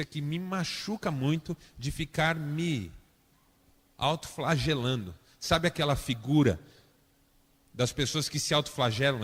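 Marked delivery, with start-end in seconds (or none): none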